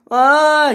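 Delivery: long, loud high "ah"; high yell of alarm; acted scream. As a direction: scream as you're hoisted up. Lifted high by the crane.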